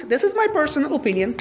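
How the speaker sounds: normal talking voice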